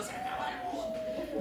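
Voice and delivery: put-on very high, thin child's wail; preacher imitating a child's tantrum wail; shrieking child. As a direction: wail like the world is ending. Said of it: "gritaba así como diciendo".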